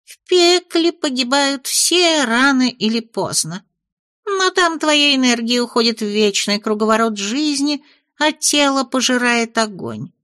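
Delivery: high, disgusted little imp. Acted. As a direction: sneer about rotting in earth. Imp dégoûté parle de l'enfer.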